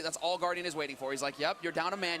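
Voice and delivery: ordinary talk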